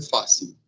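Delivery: "fácil" stressed on FÁ, -cil fading; weak last syllable. Shown as a demonstration. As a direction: say "fácil" as FAH-seew. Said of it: "a última sílaba é a sílaba fraca".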